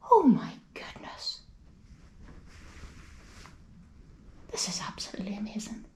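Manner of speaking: hushed, emotional awe; overwhelmed whisper. Real, not acted. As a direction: barely breathe it out, moved.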